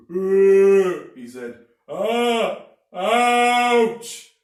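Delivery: very high, very loud strangled cry; shocked yelp. A narrator's reading. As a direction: squeal as if stung by a wasp. Mr Twit chokes in shock.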